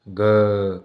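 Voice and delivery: "ग" held long with a voiced hum; सघोष उच्चारण. Shown as a demonstration. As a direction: draw out "ग" so the cords audibly vibrate. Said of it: "कंपन हो रहा है हमें". ("ग" को खींचकर, कंपन के साथ)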